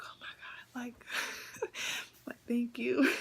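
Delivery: giggly, overwhelmed gratitude; delighted laughing thanks. Retold or imitated. New driver thanking the examiner for praise, giggling with delight. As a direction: laugh through a retold thank-you.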